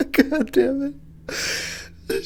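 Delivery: very slow, high, wheezing through laughter; laugh-crying. Real, not acted. Overcome by his own pun, high and laughing. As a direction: barely get it out while laughing.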